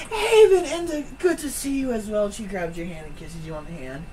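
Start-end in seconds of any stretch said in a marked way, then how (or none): 0.1-2.3 Madame Eva greeting. Acted. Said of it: "she goes".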